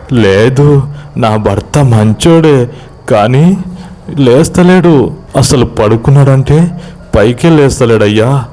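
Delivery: acted tearful wife, drawn-out words; plaintive protest. Wife tearfully defends her husband.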